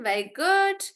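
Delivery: normal talking voice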